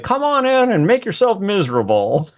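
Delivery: W. C. Fields, Barrymore. Retold or imitated. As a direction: boom it out as a theatrical comic greeting. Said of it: "W C Fields Barrymore kind of accent".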